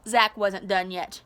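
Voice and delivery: plain reading voice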